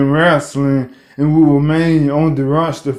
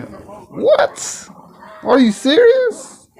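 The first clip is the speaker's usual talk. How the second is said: high, stunned what, then slow are you serious; can't believe what he's hearing; disbelieving squeak rising high; shocked disbelief; 12 semitones higher, much slower than usual. Real, not acted. Shocked at injury news.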